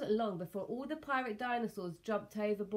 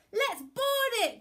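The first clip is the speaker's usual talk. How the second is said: high, loud battle call; eager captain's shout; rallying cry; 14 semitones higher, 7 dB louder than usual. A narrator's reading. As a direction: cry it out to the crew. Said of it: "cried Captain Fli".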